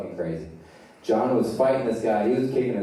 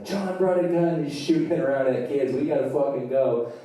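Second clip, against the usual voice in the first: high, urgent alarm; panicked warning; 6 semitones higher than usual. Acted. Friend warning that John is shooting at kids, panicked warning. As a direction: raise the alarm, pitched up.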